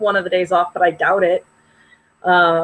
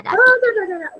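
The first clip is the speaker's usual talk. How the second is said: mock high babble; 12 semitones higher than usual. Mocking chatter, high.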